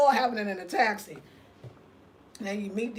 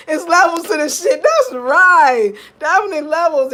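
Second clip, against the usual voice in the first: very loud, higher-pitched hype; loud excited cheering; 4 semitones higher, 12 dB louder than usual. Real, not acted. Cheering on viewers' comments as they come in, loud excited hype. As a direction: whoop your agreement at full volume.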